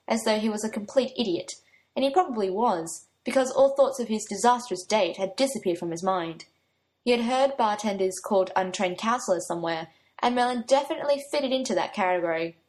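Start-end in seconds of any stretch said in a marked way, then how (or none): none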